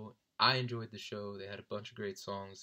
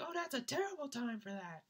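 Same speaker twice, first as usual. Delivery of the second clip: mimicking interrupting friend; 13 semitones higher than usual. Mimics friend cutting into station ID.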